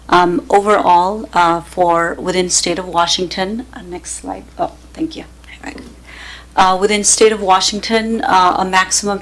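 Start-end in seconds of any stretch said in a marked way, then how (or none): 3.7-5.8 quick hushed aside to the operator; whispered aside. Real, not acted. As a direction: drop to a whisper off-mic.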